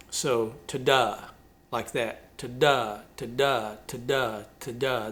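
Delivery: tapping out weak-strong beats, "ta-DA"; iambic rhythm demo. Shown as a demonstration. Teaching his listeners the song's meter, unstressed then stressed. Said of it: "That's the rhythm of the".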